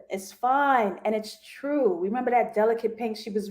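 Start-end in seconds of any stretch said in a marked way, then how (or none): none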